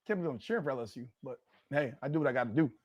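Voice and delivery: soft, fast self-deprecating mutter; quiet aside. Real, not acted. Fan sheepishly admitting to viewers he's rooting for LSU, quick and soft. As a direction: drop the voice and rush the admission. Embarrassed aside after cheering rival.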